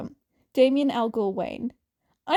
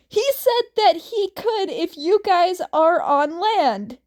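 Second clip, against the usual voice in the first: calling out loud; 5 semitones higher, 5 dB louder than usual. Acted. Dick calls back the answer.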